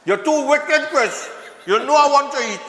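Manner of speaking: high, laughing protest. Real, not acted. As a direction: laugh through the teasing. Laughing banter about eating.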